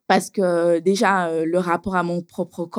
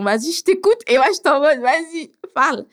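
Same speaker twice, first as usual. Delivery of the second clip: impatient demand; 8 semitones higher, 4 dB louder than usual. Retold or imitated. Impatiently pressing the therapist.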